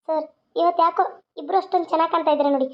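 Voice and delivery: ordinary talk, nothing marked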